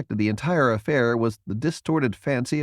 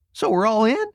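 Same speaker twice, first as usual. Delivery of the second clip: eager question; 12 semitones higher, 4 dB louder than usual. A narrator's reading. Jason eager.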